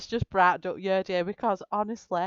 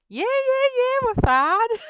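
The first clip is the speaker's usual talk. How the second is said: much higher, giggly reassurance; high, laughing, fake-calm answer; 4 dB louder than usual. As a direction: squeak it out trying not to laugh. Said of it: "And I'm at it".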